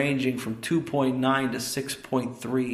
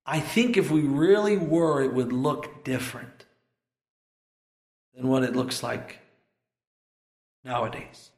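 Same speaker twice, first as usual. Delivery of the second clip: normal talking voice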